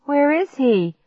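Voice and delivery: high, urgent question; panicked cry. A narrator's reading. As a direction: cry out in alarm. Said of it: "I cried".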